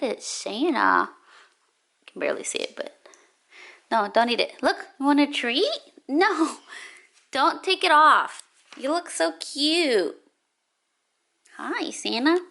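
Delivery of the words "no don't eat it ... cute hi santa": high sing-song pet talk; talking to a dog. Real, not acted. Talking to her dog Lover.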